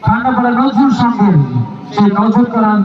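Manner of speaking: live play-by-play commentary; frantic football commentary. Acted. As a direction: call the shootout live.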